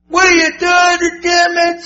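high, loud mock scolding; upset wife. Wife catches him after smelling marijuana, upset. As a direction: imitate an upset wife yelling at you. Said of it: "Ooh, is she upset".

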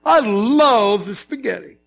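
slow scornful tone, lightly played; disgusted diner at an Italian family table; mock disdain. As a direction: sneer at the pasta. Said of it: "One thing you don't say".

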